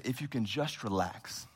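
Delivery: soft, slow, calming nurse voice; soothing nurse. Retold or imitated. Nurse calming a pastor with a dislocated shoulder, soft and slow. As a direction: coax him gently to relax. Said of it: "And she said".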